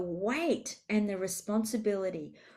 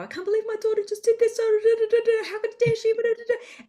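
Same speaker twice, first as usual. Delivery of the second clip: high-pitched, rapid mock chatter; women venting by phone; 13 semitones higher, 7 dB louder, faster than usual. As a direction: gabble it loud and high in outraged gossip. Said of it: "And they will go".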